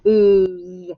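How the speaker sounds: "Whose" said slowly as /uz/, no h; drawn-out teaching say of "Whose"; sounding out whose. Shown as a demonstration. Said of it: "And the O sounds like".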